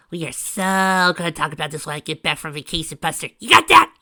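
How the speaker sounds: high, loud, mock threatening; shouting. A narrator's reading. Pinky yelling threat on phone.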